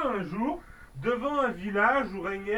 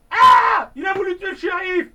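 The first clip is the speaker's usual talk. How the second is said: cri d'alarme; 10 semitones higher, 10 dB louder than usual. Acted. Foule crie au meurtre.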